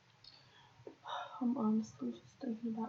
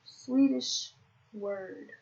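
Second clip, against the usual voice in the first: very slow, louder "Swedish word"; slow, louder "Swedish word" with each word stretched; drawn-out excuse; 6 dB louder, much slower than usual. Real, not acted. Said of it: "I'm not gonna even blame myself".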